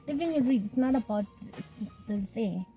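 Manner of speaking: normal talking voice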